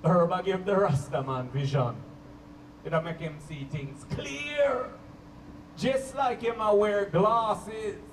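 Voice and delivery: low, slow Jamaican roots man preaching. Retold or imitated. Roots man preaches to party guests, slow low Jamaican accent. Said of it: "he'll be like".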